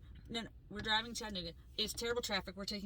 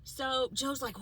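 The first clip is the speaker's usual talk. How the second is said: ordinary talk, nothing marked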